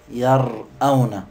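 slow يرأون with a full glottal stop after the r; heavy hamza. Shown as a demonstration. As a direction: make the hamza in يرأون laboured and separate. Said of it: "لاحظوا يرأون ثقيلة". (نطق الهمزة في يرأون ببطء وثقل)

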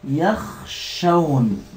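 يخشون stretched very slowly, syllable by syllable; drawn-out word form. Shown as a demonstration. Teacher shows what the plural becomes, stretching يخشون slowly. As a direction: draw out "يخشون" as yakh-shawn. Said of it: "ماذا تصير؟".

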